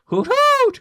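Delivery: high loud hooting owl call; owlbear hoot. Acted. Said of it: "The owlbear hoots at you aggressively".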